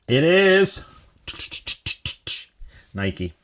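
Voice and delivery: high, loud drawn-up build to the answer; quiz-show reveal. Pretend. Host teasing the biggest brand answer, suspenseful announcement. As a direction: announce it like a game-show host before a drumroll.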